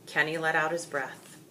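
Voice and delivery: usual speaking voice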